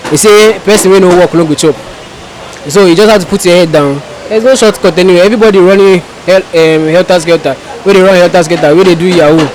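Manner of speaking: usual speaking voice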